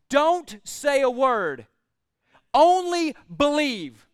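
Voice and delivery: slow, weighty, every word deliberate; firm reassurance. Acted. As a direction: hold him steady with slow words. Jesus calmly answers Jairus.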